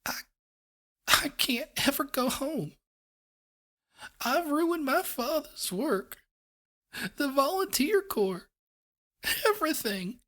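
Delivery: high, slow gasping sobs; weeping. A narrator's reading. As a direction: weep through each phrase. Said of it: "wept with tiny little gasping sobs".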